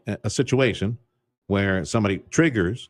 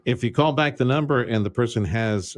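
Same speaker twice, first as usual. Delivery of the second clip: ordinary delivery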